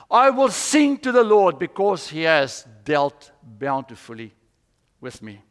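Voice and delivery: declaimed psalm reading. Acted. Preacher reads a psalm of lament to congregation, declaiming. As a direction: proclaim each line, dropping at the endings.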